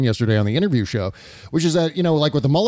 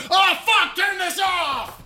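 high-pitched mock scream of revulsion; panicked disgusted shout. Listener speeding on the highway killing the podcast, mock screaming. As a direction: yell like a horrified driver. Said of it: "sound of every listener to this podcast turning off".

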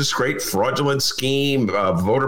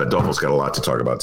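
ordinary talk, nothing marked

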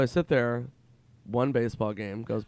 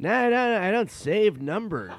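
high, loud impression, dismissive; Norm Macdonald; 10 semitones higher, 7 dB louder than usual. Norm denying he saves numbers, loud high impression. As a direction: brush it off in his pitched-up voice. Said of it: "he goes".

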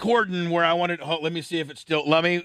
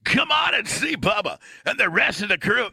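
announcer voice; 6 semitones higher than usual. Acted. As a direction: belt it out like a stadium announcer. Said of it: "that's the announcer voice you wanted".

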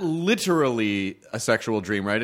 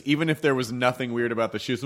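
normal talking voice

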